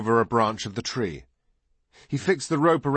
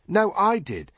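put-on high, indignant boy's retort; squabbling boy; 11 semitones higher than usual. A narrator's reading. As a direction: contradict him in a high boy's voice. Boys squabble over who was first.

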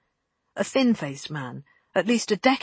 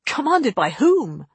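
loud, higher, drawn-out challenge; raised voice, slow incredulous demand; 5 semitones higher, 7 dB louder, slower than usual. A narrator's reading. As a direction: demand an answer, stretching it out indignantly. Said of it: "Halston demanded".

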